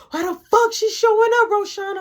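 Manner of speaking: put-on high, loud, outraged girlfriend; mock furious shouting; imitated angry yell. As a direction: act out demanding an answer at full pitch. Girlfriend's question escalates to yelling.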